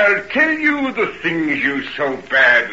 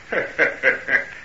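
villain's breathy snicker; sinister, self-satisfied laugh; gloating chuckle; 4 dB quieter than usual. Acted. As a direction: chuckle wickedly to yourself. Hyde gloats at catching them.

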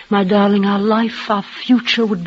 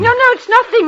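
high, shaky-sounding "no, no"; trembling denial; 13 semitones higher, 4 dB louder, faster than usual. Acted. Woman tells frightened lover she's fine, high and trembling. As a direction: deny the fear, voice pitched high. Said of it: "You're trembling".

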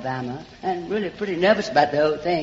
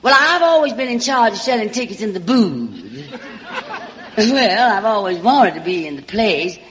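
Southern belle voice; 4 semitones higher than usual. Acted. Alabama girl sweet-talks the producer's secretary, in a Southern belle voice. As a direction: play the small-town Alabama charmer calling everyone sugar and darling.

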